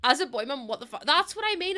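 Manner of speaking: usual speaking voice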